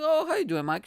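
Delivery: high, breezy casual greeting; casual warden. Retold or imitated. The warden welcoming Mike to jail, high and super casual. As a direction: greet him high and easy like nothing's odd. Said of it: "She's, like, super casual".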